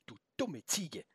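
soft, muted insult to oneself; inner thought. A narrator's reading. Felix silently insults the goat, inner thought. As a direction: think it aloud under your voice. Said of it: "Wieder dachte er".

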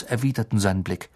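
ordinary delivery, nothing marked

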